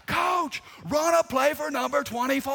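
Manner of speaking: yelling mom. Retold or imitated. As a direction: shout it to the coach like a proud mom. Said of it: "coach would call a timeout".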